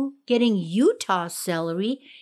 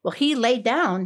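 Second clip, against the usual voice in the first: usual speaking voice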